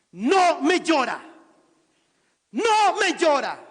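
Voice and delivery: slow, loud, high commanding call; the Lord orders her firmly to stop crying. Acted. Preacher voicing the Lord to the widow, slow loud command.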